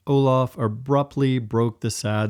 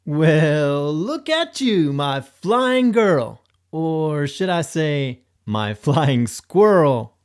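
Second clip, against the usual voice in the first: high, slow fond teasing; slow, high, teasing pet voice; playful praise; 4 semitones higher, 3 dB louder, slower than usual. Acted. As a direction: coo at the floating dog. Olaf teasing the dog fondly.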